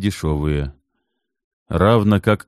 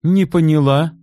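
much higher, louder indignant snap; sharp retort; 11 semitones higher, 5 dB louder than usual. Acted. Natalya turning on Kit sharply, indignant. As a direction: bristle at the insult. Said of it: "резко обернулась".

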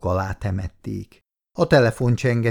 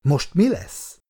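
higher, cutting, stress on "mi"; nervy, pointed husband; sharp question; 6 semitones higher, slower than usual. A narrator's reading. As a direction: snap the question at her. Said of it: "Éles hangon kérdezte".

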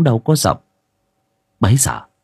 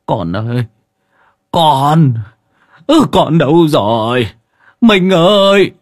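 sobbing cry, voice rising much higher and louder; tearful wail; 5 semitones higher, 5 dB louder, slower than usual. Acted. Grandfather wails for the missing grandson, tearful cry. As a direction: wail for the lost child, near tears. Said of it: "Ông mếu máo".

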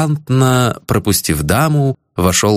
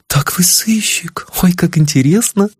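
high breathy delighted whisper; thrilled whisper; 8 semitones higher than usual. A narrator's reading. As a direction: gasp it out in delight. Lady thrilled by detective.

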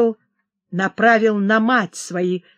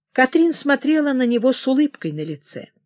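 usual speaking voice

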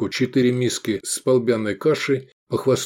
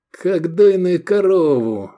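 high, loud, drawn-out derision; snorting; 8 semitones higher, 5 dB louder, slower than usual. A narrator's reading. Edik interrupting a friend's thoughts, snorting. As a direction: throw it in with slow derision. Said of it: "фыркнул Эдик".

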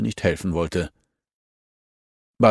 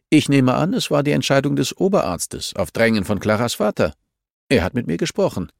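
firm, raised-pitch rebuke; lightly voiced stern official; 6 semitones higher, 3 dB louder than usual. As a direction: tell her off, a hint of authority. (leicht gespielter strenger Zurechtweiser)

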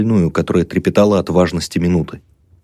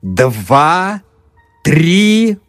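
slow, high, hollow hypnotist; sepulchral voice; 8 semitones higher, much slower than usual. Acted. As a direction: intone it slowly like a stage mesmerist. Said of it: "подражая замогильному голосу".

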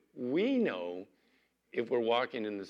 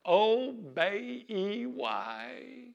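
put-on high piping child's voice; little girl voice; 11 semitones higher, much slower than usual. Little Southern girl spelling obey for her mama, high and proud. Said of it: "Brittany Lynn would go".

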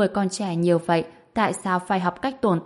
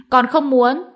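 high, loud stamping refusal; loud tantrum yell; 7 semitones higher, 8 dB louder than usual. A narrator's reading. Crying girl refusing her grandfather's scolding, yelling loudly. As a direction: shout it like a child in a tantrum. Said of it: "dậm chân hét lớn".

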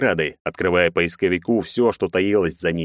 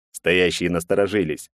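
usual speaking voice

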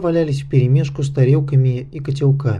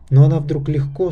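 ordinary delivery, nothing marked

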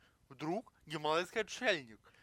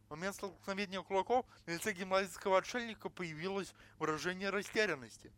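usual speaking voice

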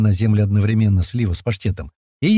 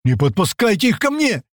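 high-pitched panicked plea; frightened; 10 semitones higher than usual. Acted. Паштет pleads with the men, frightened. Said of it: "ещё больше испугался Паштет".

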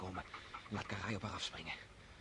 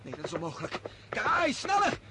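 much higher and louder, urging the dogs on; shouting; 10 dB louder than usual. Acted. Young hunter setting his dogs on the wolf, loud excited shouting. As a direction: yell the hounds at the wolf.

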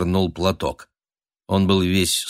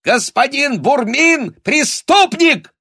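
high, loud, drawn-out shriek; shrill denunciation; shouted accusation; 6 dB louder, slower than usual. A narrator's reading. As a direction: cry out the charge, climbing on the last word. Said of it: "крикнул Норов".

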